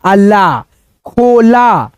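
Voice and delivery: long खो, separate ला, then joined खोला; slow teacher's blending of खोला; sounding out, then blending. Shown as a demonstration. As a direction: stretch each piece of खोला before joining. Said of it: "ख बोलेंगे और ओ की मात्रा मिला देंगे".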